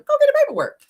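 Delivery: high-pitched taunting retort; imitated dare. Melody calls Martell's bluff, taunting. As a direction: pitch it up high, mocking him. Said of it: "That's what Melody told him".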